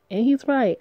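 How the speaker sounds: ordinary delivery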